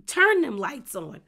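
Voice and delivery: loud, slow, every word punched; emphatic demand. Real, not acted. Vlogger telling a cheating boyfriend off, loud and slow. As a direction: lay down the law, slow and loud. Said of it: "vlogger's emphatic demand".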